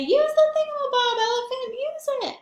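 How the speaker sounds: very high, excited coaxing voice; urging. Pretend. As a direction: cheer him on like a kid.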